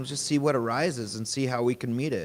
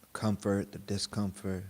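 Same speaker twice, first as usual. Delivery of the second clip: guided meditation; 3 semitones lower, 3 dB quieter than usual. Real, not acted. Teacher guiding a group to notice body and breath, guided meditation. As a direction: lead them gently inward, unhurried and even.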